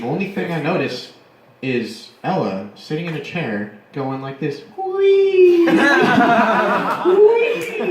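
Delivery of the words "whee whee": high, loud giddy squeal with laughter; mock drunk squeal. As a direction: imitate a drunk girl squealing as she spins. Imitating drunk Ella spinning.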